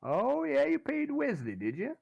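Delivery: high sly doubting thug voice; high sneering gangster; Derek Lazenby. Acted. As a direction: probe the lie with mock surprise. Derek questioning the party.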